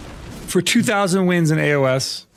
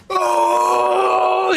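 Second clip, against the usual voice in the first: mock delighted gasp. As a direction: squeal it like a cartoon surprise. Kids' delighted reaction imitated.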